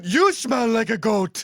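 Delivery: hammed-up furious comeback; mock angry retort. Offended cab driver throwing the insult back, mock angry. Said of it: "he got so mad, he just goes".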